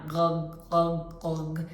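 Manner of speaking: each glug slowly and separately; slow separate glugs for the child; slow glug demo. Shown as a demonstration. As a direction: spell out each glug, one at a time. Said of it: "I'll say it a bit slowly now".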